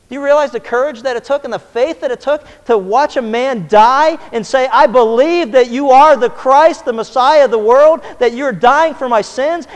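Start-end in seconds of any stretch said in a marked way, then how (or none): 4.7-9.7 louder, slow, fervent; fervent confession. Acted. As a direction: declare it with full conviction. Thief's confession of faith.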